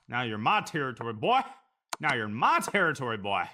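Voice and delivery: swagger it like a Southern sheriff claiming turf; Southern accent. Pretend. Streamer taunting his chess opponent, loud mock Southern accent. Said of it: "Can you use a Southern accent".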